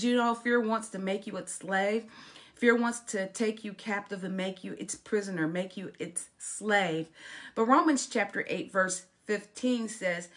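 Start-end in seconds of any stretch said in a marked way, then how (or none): none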